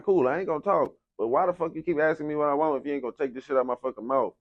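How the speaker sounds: fast, high, fed-up complaint; exasperated. Retold or imitated. Patient ranting at the nurse, fast and exasperated. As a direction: rattle it off in annoyance. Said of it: "So I'm like".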